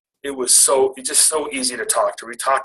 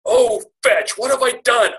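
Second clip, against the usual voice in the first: mock panic; 11 semitones higher, 3 dB louder than usual. Panicking after her "What?.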